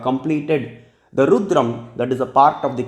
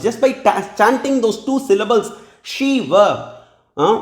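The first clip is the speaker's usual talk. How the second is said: higher, impassioned scolding of a foolish father; Sati's rebuke; 6 semitones higher than usual. Retold or imitated. Sati Mata rebuking Daksha for insulting Mahadeva, higher indignant voice. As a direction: lecture him with raised, indignant fervour. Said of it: "Sati Mata says".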